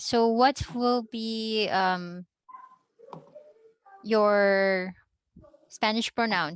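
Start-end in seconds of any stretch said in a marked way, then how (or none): none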